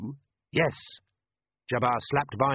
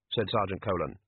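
ordinary delivery

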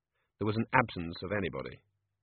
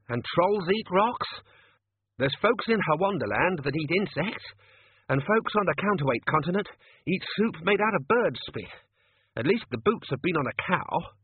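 gruff manager voice; 8 semitones higher, 3 dB louder than usual. Acted. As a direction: voice the grumbling restaurant manager. Manager continues.

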